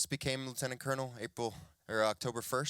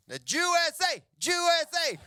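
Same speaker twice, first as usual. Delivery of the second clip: high, loud chant at the TV; imitated dad yelling; 8 dB louder than usual. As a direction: yell it like a patriotic sports fan dad. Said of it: "he's yelling at the screen".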